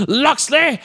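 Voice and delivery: high, strained hammed-up shout; slow, over-the-top high-pitched fury; furious villain threat. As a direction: screech the threat like an enraged movie villain. Acting villain's furious famous line.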